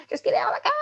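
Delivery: sharp high mock command; mother ordering kids out at school, a high mock yell; retold yell. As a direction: bark it up high like a hurried mum. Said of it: "how many times have I yelled".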